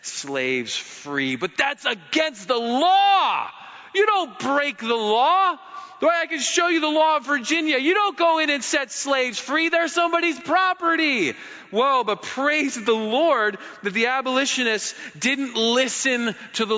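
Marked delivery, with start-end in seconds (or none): 1.4-11.3 mock self-righteous. Voicing slave-law defenders.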